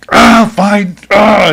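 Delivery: high, loud, exasperated giving-in; retold freaking out. Owner told to go to a vet, freaking out. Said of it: "freaking out".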